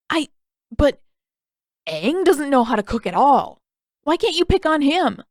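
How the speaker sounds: put-on high, loud whining boy's voice; whiny protest. A narrator's reading. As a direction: plead your case like a wronged brother.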